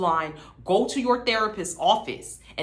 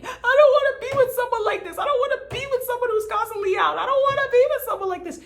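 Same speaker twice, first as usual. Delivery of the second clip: much higher, sing-song mock of her reciting; mock recital; faster than usual. Vlogger mocking Chelsea's repeated line, high sing-song. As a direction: parrot it high and fast like a broken record. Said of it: "She keeps on reciting".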